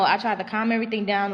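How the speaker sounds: ordinary talk, nothing marked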